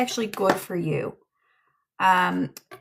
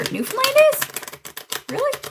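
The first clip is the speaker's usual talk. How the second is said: high, disbelieving Newfoundland is, really; incredulous; 4 dB louder, much faster than usual. Real, not acted. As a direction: pitch jumps way up in disbelief. Incredulous at viewer's geography.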